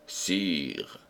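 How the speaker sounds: low, soft, offhand address; nonchalant. A narrator's reading. Renardino broaching his attack on the king, most nonchalant. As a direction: drop it casually, low and soft. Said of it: "dit-il enfin de sa voix la plus nonchalante".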